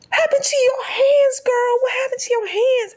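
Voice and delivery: very high, loud mock outrage; imitating a furious mother demanding answers; pissed-off mom. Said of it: "she was pissed".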